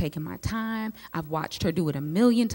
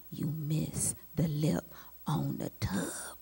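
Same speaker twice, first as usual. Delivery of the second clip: slow, soft whisper of criticism; slow whispered echo of a remembered critique; haunting whisper; 3 semitones lower, 7 dB quieter, slower than usual. Retold or imitated. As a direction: whisper it like a voice stuck in your head. Criticism echoing in memory.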